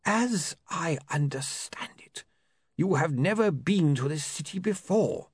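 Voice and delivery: measured, precise solicitor voice; lawyer voice. A narrator's reading.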